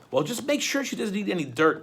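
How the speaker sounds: put-on a boy's anxious reminder; Klaus. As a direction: gently warn the judge. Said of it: "Klaus said".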